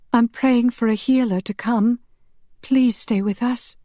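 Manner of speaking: high, distressed pleading; tearful plea. A narrator's reading. Marielle begs the dying priest to stay, tearful plea. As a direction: plead with him, pitched high. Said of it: "Tears filled her eyes".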